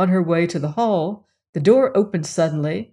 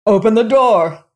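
loud, higher demand; angry shout; 4 semitones higher, 9 dB louder than usual. Acted. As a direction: shout through a door in anger. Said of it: "it was shouting".

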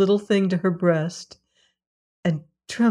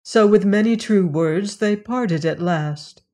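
ordinary delivery, nothing marked

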